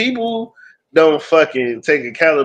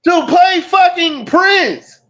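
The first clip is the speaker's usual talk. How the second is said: incredulous outrage; 14 semitones higher than usual. Real, not acted. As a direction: stretch it out in shock. Outraged at Travolta casting.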